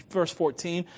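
ordinary talk

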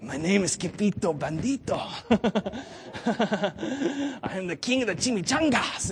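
cartoon chihuahua with a Spanish accent. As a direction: do the storybook chihuahua. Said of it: "I can do the chihuahua voice really good".

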